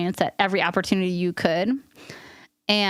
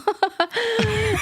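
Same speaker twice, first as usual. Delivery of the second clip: bright burst of laughter, well above her usual voice; high laugh; 14 semitones higher than usual. Real, not acted. Host laughing at her own dramatic line, then a high laugh.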